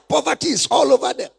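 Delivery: normal talking voice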